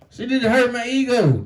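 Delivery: ordinary delivery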